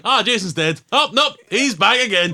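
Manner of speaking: loud, high mock sigh of a franchise that won't quit; mock exasperated narration. Mocking the endless Friday the Thirteenth sequels, loud and mock-weary. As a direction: ham up the fake surprise.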